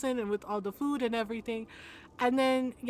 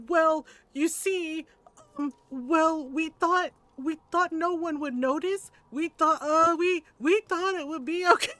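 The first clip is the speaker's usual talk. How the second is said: higher-pitched, halting mock-nervous excuse; terrified stammering; 4 semitones higher than usual. Titos explaining themselves to Lola, mock terrified stammer. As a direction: imitate grown men squirming and fumbling for words. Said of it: "they are terrified of my Lola".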